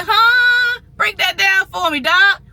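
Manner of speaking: high, loud disbelieving huh; incredulous high-pitched break that down; stunned huh. Retold or imitated. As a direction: act floored by the news. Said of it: "I'm like".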